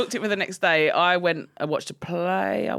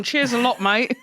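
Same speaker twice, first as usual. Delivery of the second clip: fake Alfred cockney; 4 semitones higher, 3 dB louder than usual. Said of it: "that's the fake Alfred".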